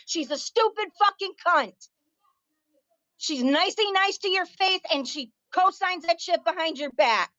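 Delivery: real indignant anger, pitched high; angry rant. Real, not acted. Ranting over nephew being doxxed.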